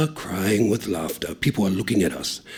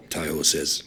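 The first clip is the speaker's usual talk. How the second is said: usual speaking voice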